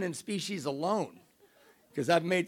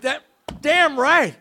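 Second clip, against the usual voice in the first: excited; 10 semitones higher, 10 dB louder, slower than usual. Retold or imitated. Elated reply to being hired.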